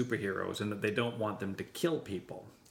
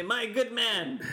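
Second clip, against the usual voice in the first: hammy stage actor; 8 semitones higher, much slower than usual. Acted. Posh shopper addresses merchant.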